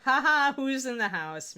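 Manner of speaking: loud gleeful crowing over a win; triumphant cheer. Real, not acted. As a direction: cheer it out like you scored. Triumphant after nailing highlight.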